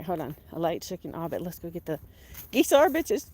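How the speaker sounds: ordinary talk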